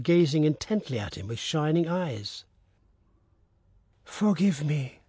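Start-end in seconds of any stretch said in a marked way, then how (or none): 4.0-5.0 faint, fading plea; weak voice. A narrator's reading. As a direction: speak it weakly, like a wounded man. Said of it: "said the bearded man in a weak voice".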